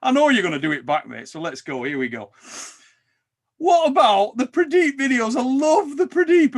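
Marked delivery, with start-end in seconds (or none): 3.6-6.6 high, louder, gleeful ribbing; excited teasing. Real, not acted. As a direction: rev up to mock a mate.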